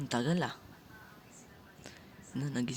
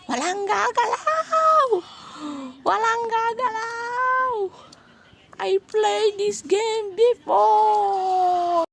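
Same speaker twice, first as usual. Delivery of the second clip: high, slow booming doll announcement; loud, drawn-out creepy doll; 10 dB louder, much slower than usual. Pretend. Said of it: "Pwede na ba maging manika, guys?".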